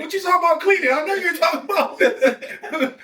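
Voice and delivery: high, loud incredulous yell through laughter; mock outraged disbelief. Acting out someone's reaction to getting clean, mock outraged disbelief.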